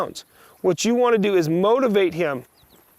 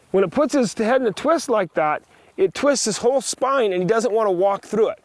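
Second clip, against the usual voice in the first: usual speaking voice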